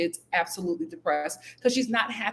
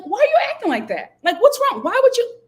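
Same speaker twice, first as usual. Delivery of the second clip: high, loud mock-innocent whine; mock gaslighting; 13 semitones higher, 7 dB louder than usual. Mocking Roxanne gaslighting crying Antonio, high and loud. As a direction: play dumb, loudly and higher. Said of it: "gaslighting him and be like".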